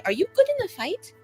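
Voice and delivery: normal talking voice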